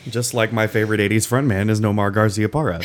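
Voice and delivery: normal talking voice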